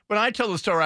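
ordinary talk, nothing marked

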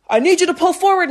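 high, loud mock yell of a rental car worker; imitating a yell. Rental car worker yelling at them, loud and high. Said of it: "just, like, yelling at us".

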